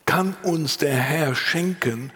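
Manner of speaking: usual speaking voice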